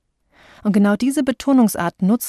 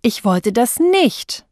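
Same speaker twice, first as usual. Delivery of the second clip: nicht punched louder, slow delivery; stress on nicht; 4 semitones higher, 4 dB louder, much slower than usual. Shown as a demonstration. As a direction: hit nicht hard at the end. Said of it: "wenn sie ihn unterschiedlich betonen".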